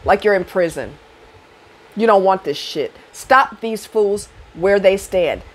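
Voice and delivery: usual speaking voice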